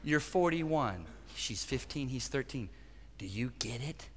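soft, low correction sinking to a near whisper; hushed, pointed questioning, voice dropped low; God's quiet rebuke. Retold or imitated. God turning a father's words back on him, quiet rebuke. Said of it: "And God said to me".

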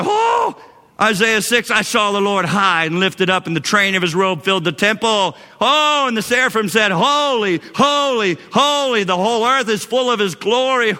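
high, swelling cries of wonder; high-pitched, reverent amazement; awestruck exclaiming. Acted. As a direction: exclaim scripture in rising awe. Voices awestruck reader of Isaiah.

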